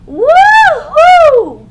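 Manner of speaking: very loud high whoop; joyful cry. A narrator's reading. Boy riding his brother like a raft, joyous whoop. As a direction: whoop with glee. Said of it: "cried Arthur".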